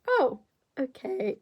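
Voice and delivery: high squeaky crack on a quoted reply; acted reply that cracks high by accident. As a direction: let the voice break upward on the oh. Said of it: "my voice cracked".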